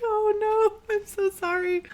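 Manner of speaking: very high-pitched apology; hammed-up high "oh no"; mock sympathy. Her acted sympathy to guard.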